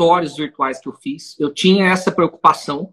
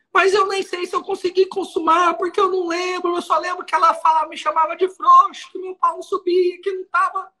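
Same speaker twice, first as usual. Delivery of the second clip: much higher, crying voice, hammed up; mock tearful defendant; 9 semitones higher than usual. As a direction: play the defendant sobbing to the judge. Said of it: "Ele em prantos falando isso".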